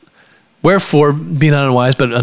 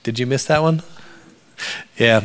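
ordinary talk, nothing marked

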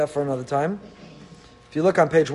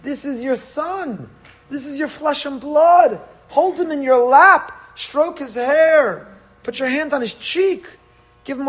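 urgent rising appeal; impassioned plea; 7 semitones higher than usual. Acted. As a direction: pitch climbs high, pleading with a mother. Pleading with Hagar to comfort son.

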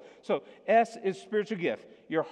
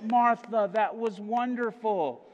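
louder and higher on the name, then slow, overdone praise; fake gushing praise; 4 semitones higher, 4 dB louder, slower than usual. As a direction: lay the fake compliment on thick. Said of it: "but we're like".

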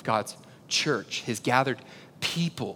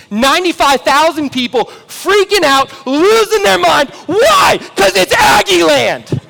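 builds higher and louder to a full shout; rising excitement; 13 dB louder than usual. Real, not acted. Preacher reliving the team's entrance, ending in a shout. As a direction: get swept up, like the crowd is roaring. Team running onto the field.

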